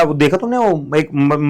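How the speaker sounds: normal talking voice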